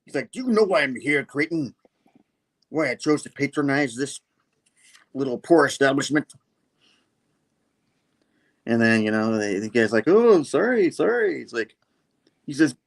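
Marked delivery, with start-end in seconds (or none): 0.3-6.3 slightly high, theatrical disdain; haughty villain. Acted. Villain lectures the henchman. 10.1-11.4 high, swooping mock apology; cowed henchman.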